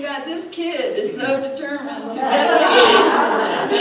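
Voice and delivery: loud, drawn-out words breaking into laughter; amused exasperation. Retold or imitated. Speaker telling workshop about the determined kid, laughing exasperation.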